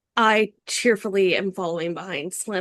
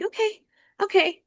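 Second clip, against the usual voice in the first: high, rattled and breathless; jittery, still processing the fight; anxious; 12 semitones higher than usual. Acted. Gnome anxiously asking the party what happened, high. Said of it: "Just nod anxiously".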